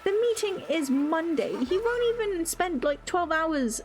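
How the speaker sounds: venting angrily about a fussy colleague; exasperated rant. Real, not acted. Ranting at absent coworker.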